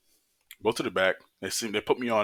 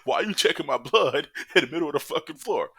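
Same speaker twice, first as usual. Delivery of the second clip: pissed off; 7 semitones higher than usual. Retold or imitated. To the nurses about no private room, outraged high yell. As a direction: blow up at being stuck on a gurney. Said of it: "I was pissed".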